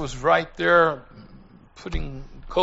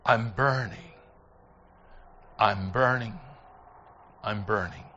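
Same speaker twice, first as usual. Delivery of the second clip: low, soft, spaced-out repetition; retold dying murmur; fading last words; 7 semitones lower, 7 dB quieter than usual. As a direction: let each one sink lower and fainter.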